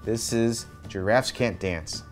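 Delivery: plain reading voice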